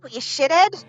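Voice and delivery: high, loud parrot shouting through a door; parrot yell. Acted. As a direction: yell it as an angry bird. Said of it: "The parrot yells through the door".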